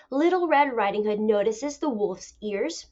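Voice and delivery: ordinary talk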